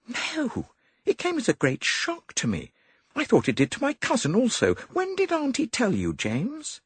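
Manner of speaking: put-on high, light young woman pleading; young woman's voice. A narrator's reading. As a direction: voice Molly as a worried young lady.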